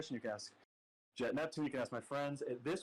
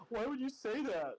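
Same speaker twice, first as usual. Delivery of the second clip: high, drawn-out mock-distressed plea; imitating crying; 9 semitones higher than usual. Friend tearfully confronting him on a video call, mock crying. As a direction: retell it as a pitched-up, hurt protest.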